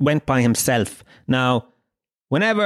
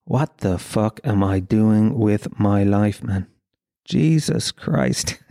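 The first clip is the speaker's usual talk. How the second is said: slow, drawn-out despairing aside; darts referee's existential crisis; 5 semitones lower, slower than usual. Acted. Darts referee questioning his life right after the call, slow disillusioned voice. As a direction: let it sink slowly into weary self-questioning. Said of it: "And then instantly gone".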